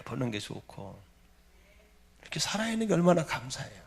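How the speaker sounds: soft, low pastoral reflection; gentle preaching. Real, not acted. Pastor telling congregation of gratitude for family, gentle. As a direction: bring the voice right down, intimate and thankful. Gentle close of sermon point.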